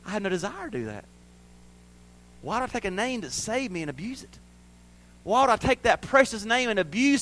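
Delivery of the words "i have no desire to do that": soft, quick personal aside; gentle conviction. Retold or imitated. Grace teaching the believer, gentle conviction. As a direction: say it softly as your own wish. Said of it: "teaches us".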